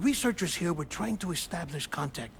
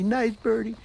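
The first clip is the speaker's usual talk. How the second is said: nice birdie coaxed higher, stress on nice; sing-song coaxing, pitched up; higher pet-talk coo; 6 semitones higher, 4 dB louder than usual. Acted. Coaxing birds, said twice.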